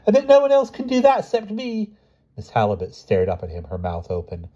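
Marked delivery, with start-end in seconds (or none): 0.1-2.0 put-on high, squeaky show-off kid; cocky little boy, pitched way up; boastful boy. A narrator's reading. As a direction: brag in a high child's voice. Boy showing off his flying.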